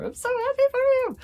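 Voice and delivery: squealing high and fast with delight; a card's horse squealing with excitement; giddy excited horse. Retold or imitated. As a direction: gush it out like you can't contain yourself. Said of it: "that's the vibe I'm getting".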